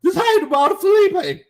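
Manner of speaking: replaying the voice crack for the chat, squeaking; deliberate squeak. Shown as a demonstration. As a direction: copy the crack on purpose, high on Felipe. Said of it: "y'all heard my voice squeak".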